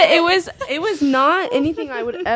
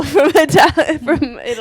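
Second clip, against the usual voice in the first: laughing; 4 semitones higher, 5 dB louder than usual. Real, not acted. Laughing while joking about Italians.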